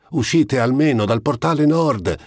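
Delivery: high, loud shout from the doorway; shouted after them. A narrator's reading. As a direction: call after them across the square. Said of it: "gridò loro dietro lo scaccino".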